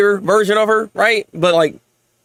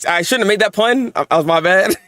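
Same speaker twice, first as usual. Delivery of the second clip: normal talking voice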